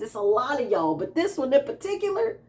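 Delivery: ordinary talk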